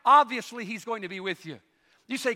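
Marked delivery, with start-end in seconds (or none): 0.1-0.6 emphatic. Shown as a demonstration. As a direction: Obviously hit loud and high. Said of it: "Do you know why?".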